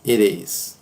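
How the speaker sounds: "It is" linked, t sounding like a tapped R; flapped t. Shown as a demonstration. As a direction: say "It is" as if spelt "ih-reez". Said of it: "Como se tivesse um R".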